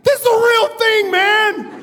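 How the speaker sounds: high, loud, frantic cry; wild-eyed panic. Retold or imitated. Pilot warning his passenger of real danger, wild-eyed panic. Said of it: "He looks at me with wild eyes".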